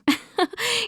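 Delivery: short, high chuckle; victorious chuckle. Acted. Character has won the battle of wits, victorious chuckle. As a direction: let out a brief laugh of triumph.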